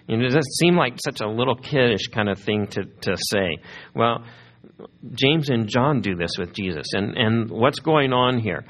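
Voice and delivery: ordinary talk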